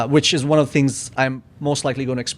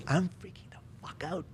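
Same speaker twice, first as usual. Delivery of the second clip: whispered mock panic, very soft; panicked whisper; 2 semitones higher, 18 dB quieter than usual. Speaker retells his first ceremony's spiralling thoughts, panicked whisper. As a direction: whisper it like a frantic inner thought.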